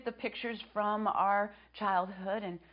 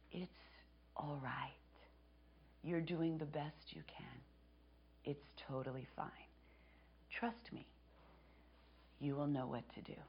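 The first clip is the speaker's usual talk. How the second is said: soft and hushed, almost a whisper on all right; tender reassurance, breathed softly; quiet, gentle voice; 4 semitones lower, 12 dB quieter than usual. Retold or imitated. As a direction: soothe yourself like a kind inner voice. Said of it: "a softer voice came, one that was quiet and gentle".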